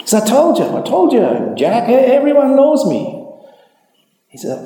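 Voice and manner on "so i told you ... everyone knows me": high, loud gloating told-you-so. Acted. Bubba gloating at Jack after winning, high and loud. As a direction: rub it in, delighted.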